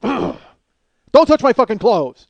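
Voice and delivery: sputter, then yell it as you retreat; acted-out screaming. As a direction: impotent mock-furious shout. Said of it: "I'm fucking screaming at him".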